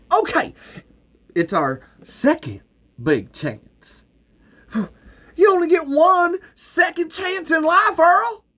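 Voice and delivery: very high, loud insistent cry; pleading shout. Acted. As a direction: yell the last line at Earl. Val shouting at Earl.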